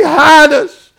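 loud, high cry of hiding sinners; panicked crowd crying out; terrified plea. Acted. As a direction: beg to be hidden. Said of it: "And they say".

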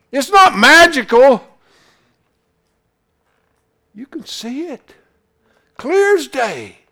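high, loud preacher's cry, voicing God's call; fiery preaching. Preacher to his congregation about God's thirst, hollering. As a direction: lift the congregation with a ringing holler.

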